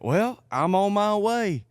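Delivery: high, loud, drawn-out challenge; mock threatening holler. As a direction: holler a slow warning into the phone. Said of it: "And he's like".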